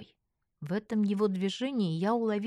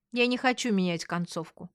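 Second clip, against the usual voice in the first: ordinary delivery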